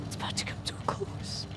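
breathy dramatic whisper. Shown as a demonstration. Telling viewers the series is ending, whispered. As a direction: hush it like a secret.

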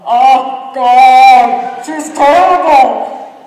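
loud, high, sing-song jeer; mocking spectator. Imagined spectators jeer at the struggling girl, loud and sing-song. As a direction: drag it out like a taunting onlooker.

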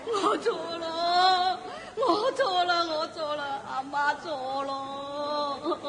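slow, fading words through tears; sobbing apology. Acted. Mother weeps her apology to the son who cursed her. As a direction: beg forgiveness, weeping.

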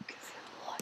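hushed unvoiced whisper to herself. Real, not acted. Girl playing a duck game, whispering to herself. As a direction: whisper it under your breath.

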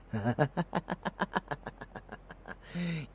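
long, high evil cackle. Acted. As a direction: cackle eagerly, letting it trail away. Villain hears enemies coming.

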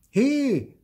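high, loud hey; indignant child's cry; protesting shout. A narrator's reading. Theo protests at snatched shovel.

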